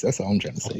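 low gravel voice. Real, not acted. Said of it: "[name]'s got that low gravel voice right now".

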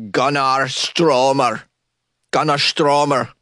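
drawn-out mock Swedish pronunciation of the name; attempted Swedish accent. Shown as a demonstration. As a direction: say it slowly with a put-on Swedish accent. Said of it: "let me try and say it".

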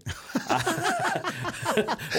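big burst of laughter; hearty laugh. Real, not acted. Laughing at his own joke.

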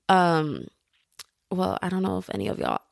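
ordinary talk

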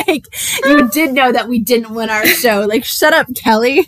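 loud, laughing mock outrage. Real, not acted. Teasing a friend after a story, loud mock outrage. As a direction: pretend to be outraged at your friend.

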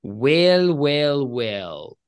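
slow, drawn-out, falling well-well-well; cold villain voice. Acted. Game master voices a menacing figure, coldly. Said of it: "you hear a cold voice say".

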